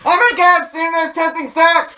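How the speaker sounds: high, loud hammy outburst; mock recap shout. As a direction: yell it like a dramatic TV flashback. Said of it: "Last time on the Joe Alex podcast".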